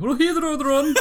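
high, loud mock Kerry accent; exaggerated Irish Kerry brogue, squeezed out high. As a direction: ham up a thick Kerry accent on one word. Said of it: "That's my best Kerry accent".

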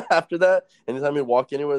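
usual speaking voice

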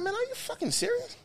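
high, hushed disbelief; can't believe what he's seeing; incredulous. Retold or imitated. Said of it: "I'm like".

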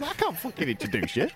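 high-pitched mock indignation; exasperated refusal. Telling Glenn he can't introduce him, exasperated. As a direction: protest it in a high voice. Acted argument over an introduction.

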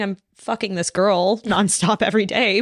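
usual speaking voice

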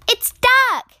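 high, loud storybook shriek; excited screech. A narrator's reading. Friends bursting in on the duck, screeching. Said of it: "screeched as they burst through the door".

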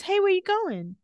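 high, surprised call after; high puzzled Henry. Retold or imitated. Henry calls after Damon.

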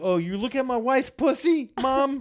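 high loud mock outrage; shrill mock confrontation; mock indignant husband. Mocking husband confronting mom.

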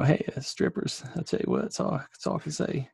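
high, husky politician impression; Bill Clinton. Host as Clinton remarking on strippers, high husky impression. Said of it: "Let me find this Bill Clinton".

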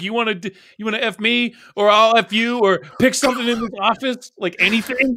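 mock desperate bargaining; hammed-up plea. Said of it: "He's like".

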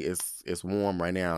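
ordinary delivery

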